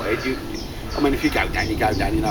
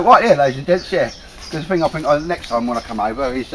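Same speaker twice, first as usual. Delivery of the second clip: normal talking voice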